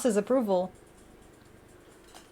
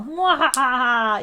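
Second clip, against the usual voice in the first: slow hammed-up villain cackle; mock evil mwahaha; mock evil laugh; slower than usual. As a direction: play the cartoon villain.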